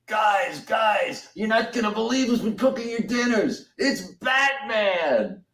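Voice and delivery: excited announcer call to the hall; calling out to the room. Retold or imitated. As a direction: shout across the dining hall to everyone. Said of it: "you hear him go".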